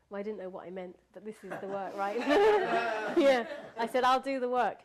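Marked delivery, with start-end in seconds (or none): 2.2-4.8 loud, amused restatement; laughing. Real, not acted. Storyteller owning up to her promise to God, laughing. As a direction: say it louder through a laugh.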